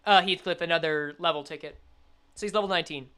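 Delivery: ordinary delivery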